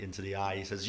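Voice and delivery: usual speaking voice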